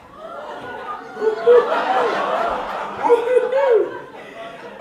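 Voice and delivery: long loud high cackle of glee; gleeful cackle. Acted. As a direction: cackle with mischief. Gleeful laugh before streaking.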